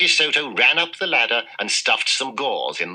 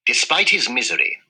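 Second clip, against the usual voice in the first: usual speaking voice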